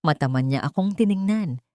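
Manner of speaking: ordinary delivery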